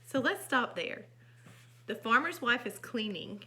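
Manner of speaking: plain reading voice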